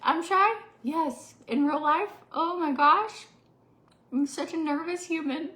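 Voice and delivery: high, loud, incredulous; surprised. Real, not acted. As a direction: act shocked at the question. Mock-offended disbelief at being called shy.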